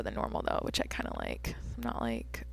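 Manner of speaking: soft unvoiced whisper. Real, not acted. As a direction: whisper it like a confession. Drops into whisper aside.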